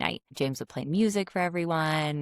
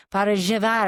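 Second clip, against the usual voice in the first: louder repeat; 6 semitones higher, 7 dB louder than usual. Retold or imitated. Misunderstood tourist repeats her coffee order louder, with the local "zh" sound. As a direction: raise the voice and spell out "Para llevar" for the barista. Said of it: "And I'd be like".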